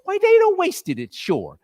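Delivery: high, cackling crone gloats over the loot; gloating old charwoman. Acted. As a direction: relish every stolen thing.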